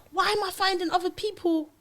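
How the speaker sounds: normal talking voice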